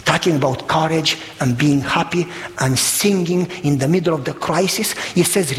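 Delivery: normal talking voice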